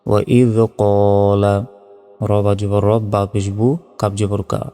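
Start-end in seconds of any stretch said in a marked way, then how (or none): 0.1-1.7 কলা chanted with its first vowel held briefly; a short sustained "qaa" in কলা; drawn-out vowel. Shown as a demonstration. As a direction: slowly intone কলা, stretching the "qaa". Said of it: "এক second টান হবে".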